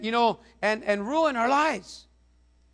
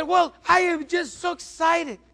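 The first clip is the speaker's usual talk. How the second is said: high gushing widow voice; excited widow; 8 semitones higher, 4 dB louder than usual. Retold or imitated. Widow explaining to pastor, high excited voice. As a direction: sound thrilled like the old lady. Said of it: "She said".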